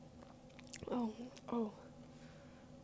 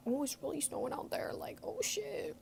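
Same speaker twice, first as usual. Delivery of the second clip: surprised; 5 semitones higher than usual. Retold or imitated. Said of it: "I was like".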